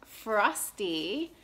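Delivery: ordinary delivery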